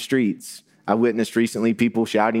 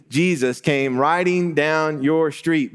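ordinary talk

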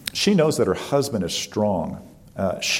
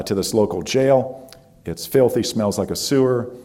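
ordinary delivery

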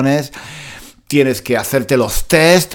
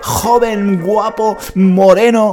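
mock dramatic lament; 10 semitones higher than usual. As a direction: wail it like a tragedy.

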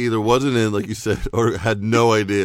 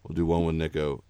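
normal talking voice

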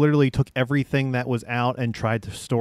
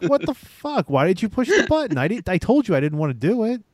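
retold outrage; 9 semitones higher than usual. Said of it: "And I'm like".

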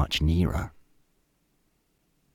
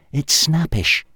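higher, odd voice explaining fire; fussy inventor voice; caveman inventor voice; 8 semitones higher than usual. A narrator's reading. Og telling Hal the fire bites, fussy.

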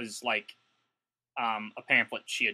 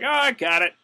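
high, louder helpful "I got it"; eager clerk; 11 semitones higher, 8 dB louder than usual. Retold or imitated. Kinko's clerk rushing to fix the jam, high and eager. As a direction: jump in brightly to help. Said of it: "I was like".

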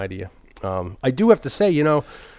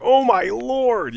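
much higher, slow mock cry; Chris Farley impression; 11 semitones higher, 4 dB louder, much slower than usual. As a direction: drag it out like a hammed-up panic. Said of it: "where he's like".

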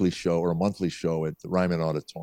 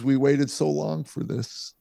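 high, slow, choked-up retelling; mock tearful relief, pitched up; imitated tearful wife; 7 semitones higher, slower than usual. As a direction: draw it out as if crying. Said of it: "she starts crying".

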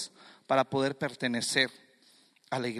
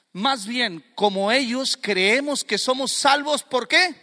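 building louder and higher toward a question; forceful preaching; 7 semitones higher, 6 dB louder, slower than usual. Acted. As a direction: drive the congregation toward the answer.

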